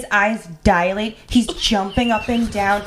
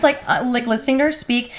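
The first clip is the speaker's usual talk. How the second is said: normal talking voice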